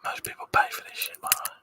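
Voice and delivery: soft breathy whisper into the mic; hushed ASMR whisper for the stream chat. Pretend. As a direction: whisper like you're lulling viewers to sleep. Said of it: "Would you like some A S M R?".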